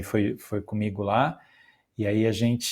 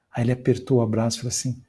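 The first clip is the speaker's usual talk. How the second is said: ordinary talk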